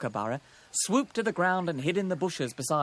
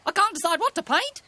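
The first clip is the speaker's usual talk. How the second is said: high, loud cartoon magpie; loud high-pitched bird character; 6 dB louder than usual. A narrator's reading. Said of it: "Sticky Beak sighed".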